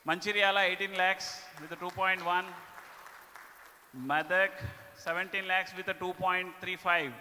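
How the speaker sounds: loud, upbeat awards announcer; award announcement. Acted. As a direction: announce the winners to the room. Announcing top states and branches.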